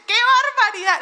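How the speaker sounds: an outraged listener, hammed up; mock shock. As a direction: play the scandalised listener. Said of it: "Y uno puede decir".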